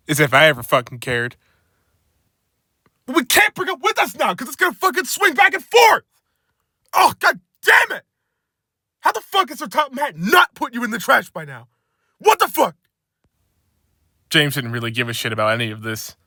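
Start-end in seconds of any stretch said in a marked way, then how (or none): 3.0-12.8 high exasperated yelling; angry yelling. Acted. Angry workman yells about the broken crane. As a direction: yell your frustration high.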